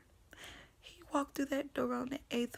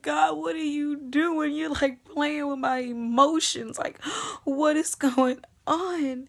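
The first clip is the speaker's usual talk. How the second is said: anguished outcry; 3 semitones higher, 6 dB louder than usual. Retold or imitated. Crying out to God.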